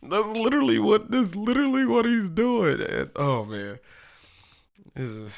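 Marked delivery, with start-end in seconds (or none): 0.1-2.8 high, loud, cracked-up exclamation; loud high-pitched hilarity; real amused outburst. Real, not acted. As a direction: exclaim just after laughing hard, voice way up. Talking straight out of hard laughing.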